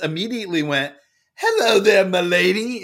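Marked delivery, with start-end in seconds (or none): 1.4-2.8 higher, louder mock posh British greeting; British accent. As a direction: play a courtly British shop boy bowing to a princess. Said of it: "in a British accent with a little hand flourish".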